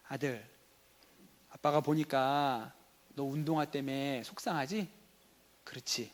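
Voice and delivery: soft, low, gentle acted voice; understanding father. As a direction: play a dad gently drawing out his son. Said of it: "진짜 대화를 잘하는 아빠는 이렇게 하는 거야". (낮고 부드럽게 공감하는 아빠 연기)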